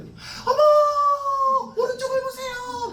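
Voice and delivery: very high-pitched, loud squeal of delight; exaggerated falsetto exclamation, drawn out; excited women passengers. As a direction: mimic women gushing at the view. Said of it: "뒤에서 이제 우리 여자 전사님들".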